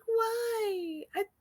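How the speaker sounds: high, whiny protest with a cry in it; whiny complaining. Retold or imitated. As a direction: whine like a reluctant student. Mocking his own whining to mentor.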